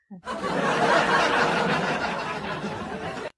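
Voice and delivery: long gloating cackle; loud evil cackle. Real, not acted. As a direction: cackle in triumph. Mocking haters as dumb. (tawa jahat keras)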